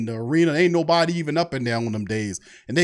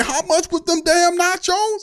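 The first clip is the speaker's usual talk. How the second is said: much higher, exclaimed in disbelief; incredulous outburst; 12 semitones higher than usual. Retold or imitated. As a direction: squawk at the nacho price. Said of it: "I'm like".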